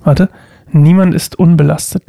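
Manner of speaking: usual speaking voice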